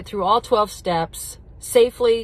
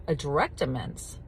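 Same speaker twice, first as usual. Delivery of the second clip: usual speaking voice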